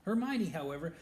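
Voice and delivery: ordinary delivery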